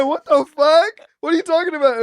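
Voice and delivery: loud, high-pitched incredulous question; hammed-up shocked reply; mock alarmed disbelief. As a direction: reenact panicked confusion through a laugh. Retells own alarmed reply.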